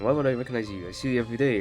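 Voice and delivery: ordinary talk, nothing marked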